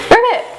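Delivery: high, quick pretend croak; playful high-pitched frog call; frog ribbit. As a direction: imitate a frog's ribbit.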